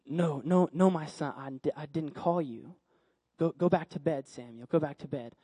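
put-on low, soft, patient older mentor; drowsy pastor. Pastor sends boy back to bed, drowsy. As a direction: send the boy off gently, repeating yourself.

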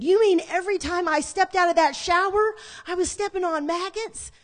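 high, loud incredulous question; horrified disbelief. Retold or imitated. Woman learning about maggots from sister, horrified disbelief. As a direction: act out appalled disbelief, pitched right up.

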